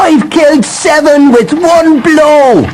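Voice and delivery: very high, slow proud boast; proud shouting. A narrator's reading. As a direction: crow it through the streets. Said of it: "ran along the streets shouting".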